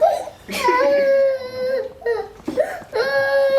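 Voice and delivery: long high peal of laughter; loud laughter. Real, not acted. Host laughing hard at the meeting, loud laughter. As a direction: laugh helplessly.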